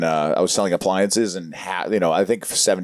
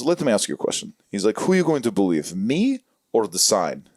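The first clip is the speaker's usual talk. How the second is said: put-on Armenian salesman accent. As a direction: lean in like a smooth Armenian salesman pitching. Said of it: "my Armenian buddy, Armin".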